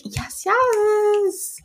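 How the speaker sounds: high, gleeful yeses of triumph. Retold or imitated. Storming out after throwing a drink, triumphant high yeses.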